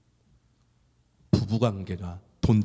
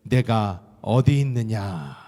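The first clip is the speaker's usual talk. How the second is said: voice of God calling; 6 dB louder, much slower than usual. Acted. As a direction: let God ask it slowly and gravely. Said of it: "이렇게 말씀을 하세요".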